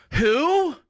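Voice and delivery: high, loud disbelieving clerk; loud, high clerk's Who, baffled by the name. Retold or imitated. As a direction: yelp the word Who like a stunned clerk.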